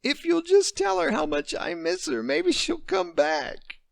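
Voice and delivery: put-on a little girl pleading through tears; crying child. Daughter begging her father through tears. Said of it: "Tears spilled over from her coffee brown eyes".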